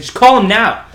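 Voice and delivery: loud, high insistent shout; urgent retold order. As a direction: shout the order over a fight. Retold urgent order during a fight.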